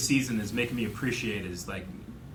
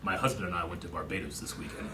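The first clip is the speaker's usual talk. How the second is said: lower, softer flat-faced imitation; a hint of a stone-faced captain reporting joy; deadpan captain; 7 semitones lower, 6 dB quieter than usual. Captain describing his happy weekend, lightly voiced deadpan.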